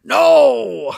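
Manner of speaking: high, loud, drawn-out hammed-up cry; Liam Neeson. Reading out Qui-Gon's comic dialogue, hammed-up Liam Neeson cry. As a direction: wail it out, sliding down from high. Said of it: "my best Liam Neeson voice".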